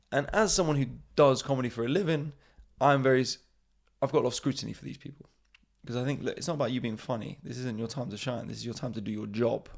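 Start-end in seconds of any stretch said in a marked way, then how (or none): none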